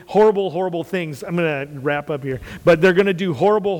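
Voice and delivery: ordinary talk, nothing marked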